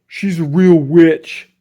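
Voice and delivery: put-on a bigger kid spooking the younger ones. A narrator's reading. Big kid warns Miss Nelson's kids, slow and loud.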